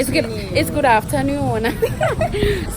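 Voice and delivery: high, drawn-out, laughing greeting; slow, singsong self-correction; playful correction. Real, not acted. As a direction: stretch out AFTERNOON with a laugh. Playfully echoing friend's correction of greeting.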